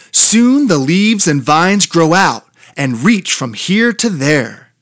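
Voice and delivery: plain reading voice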